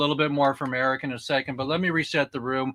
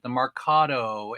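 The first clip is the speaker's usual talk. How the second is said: normal talking voice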